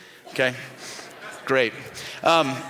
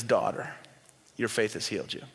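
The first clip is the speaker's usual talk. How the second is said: tender blessing; 6 semitones lower, 9 dB quieter than usual. Retold or imitated. Jesus speaks to the kneeling woman.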